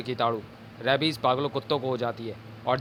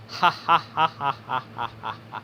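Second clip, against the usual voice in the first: evil laugh. Acted. Villain gloats over attack.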